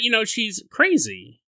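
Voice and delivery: ordinary talk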